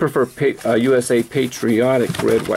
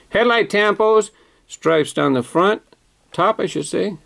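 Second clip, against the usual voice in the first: usual speaking voice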